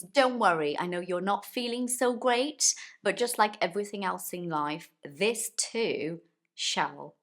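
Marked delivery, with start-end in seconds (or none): 5.0-7.1 drawn-out, deliberate build; slow catchphrase. Shown as a demonstration. Teacher slowing her motto to reassure learners. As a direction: stretch each word slowly. Motto slowed before whispered end.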